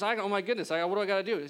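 panicked. Retold or imitated. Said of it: "he freaks out and goes".

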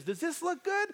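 put-on high-pitched small girl's voice; imitated little daughter. Five-year-old shows dad her bathing suit, imitated child voice. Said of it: "She goes".

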